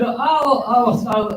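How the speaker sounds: imitating himself showing off fast American English; mock fast American English. Recalling his old fast talk to foreign clients, mock American. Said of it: "if I talk something like this".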